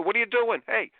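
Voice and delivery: imitating a parent yelling at kids; mock yell. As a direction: snap at the kids mid-rant.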